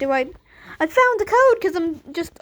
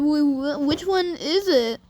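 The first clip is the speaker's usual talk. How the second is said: ordinary talk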